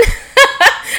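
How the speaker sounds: high, loud laughing burst; burst of laughter. Real, not acted. Laughing at her own Peloton news.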